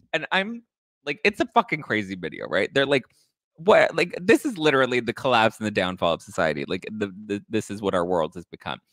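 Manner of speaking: ordinary talk, nothing marked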